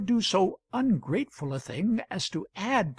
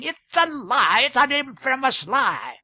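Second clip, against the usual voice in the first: outraged shout; 8 semitones higher, 7 dB louder than usual. A narrator's reading. Billson on his feet denouncing Wilson's story, outraged shout. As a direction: shout it out in full indignation. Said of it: "was on his feet and shouting".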